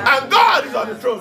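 loud, high shout of praise; full-voiced preacher's shout. Shown as a demonstration. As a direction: shout it out over the room. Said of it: "do you see how I was shouting?".

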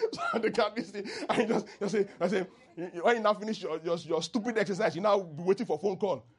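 ordinary talk, nothing marked

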